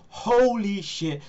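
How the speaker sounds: high, slow, drawn-out shock; mock stunned realisation. Wrestler learning who her husband is, mock dread. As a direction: stretch it out as it dawns. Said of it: "And he's like".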